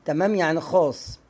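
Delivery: usual speaking voice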